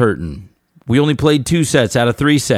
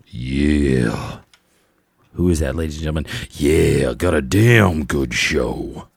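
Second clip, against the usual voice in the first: much lower, slow put-on voice of the twin hosts; Hodge Twins impersonation; 9 semitones lower, slower than usual. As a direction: drop the voice low and drag it out like a hype host. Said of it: "That's my Besh Hodge Twins impersonation".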